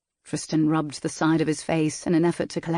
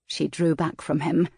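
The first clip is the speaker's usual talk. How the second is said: ordinary delivery, nothing marked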